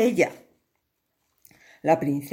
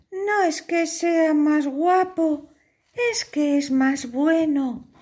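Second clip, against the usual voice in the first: put-on much higher, girlish pleading; pleading princess; 9 semitones higher than usual. Princess begs her father, the king, high and pleading. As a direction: beg your father sweetly.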